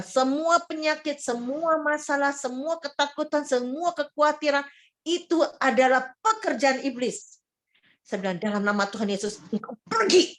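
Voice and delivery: commanding out sickness with a loud, high voice; loud proclamation. Retold or imitated. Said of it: "saya dengan lan-, lantang ya namanya".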